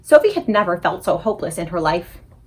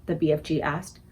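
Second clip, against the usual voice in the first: usual speaking voice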